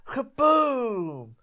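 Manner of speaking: loud high kaboom, mouth gunshot sound; imitated gunshot. Telling the hunt story, she yells the gunshot boom. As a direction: shout the blast like a rifle going off. Retelling the rifle shot.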